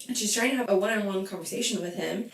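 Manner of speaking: ordinary talk, nothing marked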